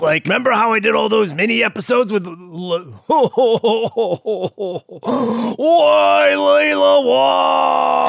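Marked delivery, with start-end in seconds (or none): 3.1-6.0 laughing sob. Acted. Breaks down. 6.0-8.1 anguished wail. Acted. Heartbroken wail again.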